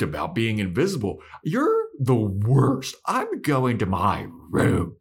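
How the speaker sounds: high-pitched teenage tantrum, hammed-up; acted indignant outburst, swooping high; mock outrage. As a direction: whine and rant like an exasperated little sister. Acting out Dawn's angry outburst at Buffy.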